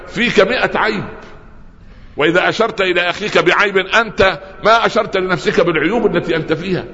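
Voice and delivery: preaching at full force. Acted. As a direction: lift the voice and rebuke the congregation. (وعظ حماسي بصوت مرتفع)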